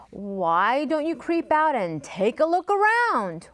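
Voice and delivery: ordinary delivery, nothing marked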